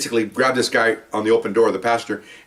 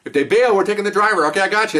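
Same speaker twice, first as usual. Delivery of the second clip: higher-pitched acted radio talk; acted chase call, pitched up; radio exchange; 8 semitones higher than usual. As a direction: relay it briskly as over the radio. Acts radio exchange during chase.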